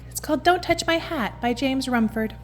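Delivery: ordinary delivery, nothing marked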